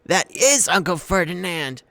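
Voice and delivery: loud, high, horrified gasp. A narrator's reading. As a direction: realise it in terror. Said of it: "Grover gasped".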